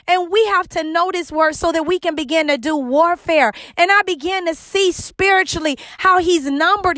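high, rising preacher's fervor; preaching. Acted. Host preaching to listeners about spiritual warfare, fervent and high. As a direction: lift it up like a pulpit climax.

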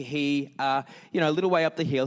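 ordinary delivery

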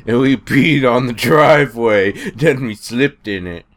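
lightly voiced old-timer lecturing; a hint of an old man ranting about youth; back in my day rant. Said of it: "That's my impression".

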